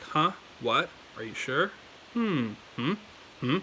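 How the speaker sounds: "Huh", "What", "sure" each lifted at the end; doubtful question lilt on every "Hmm"; questioning, rising tone. Shown as a demonstration. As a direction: ask it with the pitch climbing, "Hmm" rising too. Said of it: "like you're asking a question".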